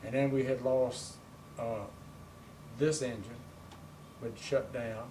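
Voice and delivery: usual speaking voice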